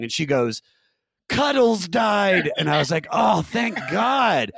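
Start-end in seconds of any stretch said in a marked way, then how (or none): 1.3-2.5 loud, high, drawn-out mock wail; mock weeping. Mother breaking news of the cat's death, mock weeping. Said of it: "my mom calls me weeping". 3.1-4.5 high, slow, exaggerated cry of relief; hammed-up relieved holler, drawn right out; mock relieved shout. Son reacting to mother's news about the cat, mock relieved shout.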